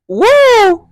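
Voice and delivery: loud, high whoa; exclaimed whoa. Real, not acted. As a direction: call it out high and loud, taken aback. Reacting to men bottling up tears.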